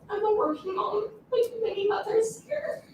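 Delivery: normal talking voice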